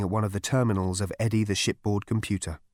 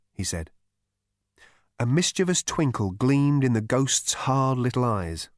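ordinary delivery, nothing marked

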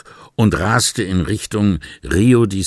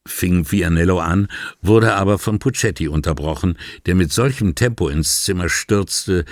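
ordinary delivery

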